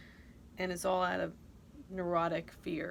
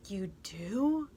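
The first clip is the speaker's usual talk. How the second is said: gasped whisper. Retold or imitated.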